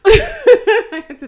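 bursting out laughing. Real, not acted. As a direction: laugh through the words. Laughing at own mix-up.

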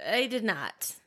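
quiet repeat. Real, not acted. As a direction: say it again under your breath.

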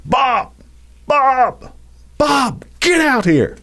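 high repeated calling yell. Retold or imitated. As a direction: shout the name up at the house, pitch high. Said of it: "He just starts yelling".

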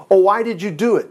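normal talking voice

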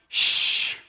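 whispered shh, barely a breath; gentle shush. Retold or imitated. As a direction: hush the storm like a child. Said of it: "maybe he just went".

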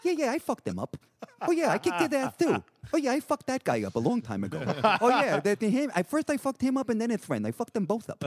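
high, lisping mock mumble; mumbling Patrick. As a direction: mimic a mellow friend's offhand lisped boast. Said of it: "Patrick would mumble".

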